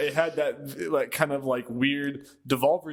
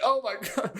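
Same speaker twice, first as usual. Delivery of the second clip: surprised; 8 semitones higher than usual. Retold or imitated. Retelling trailer reveal reaction.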